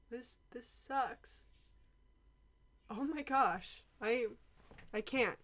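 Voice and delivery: soft, halting disbelief; deflated grief. Acted. Student takes in the friend's death, deflated grief. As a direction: let the voice drop away, stumbling over the words.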